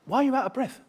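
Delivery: mock director question.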